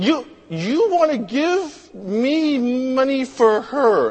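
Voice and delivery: high, halting question; stammer, then stretch it out in disbelief; incredulous cashier. Retold or imitated.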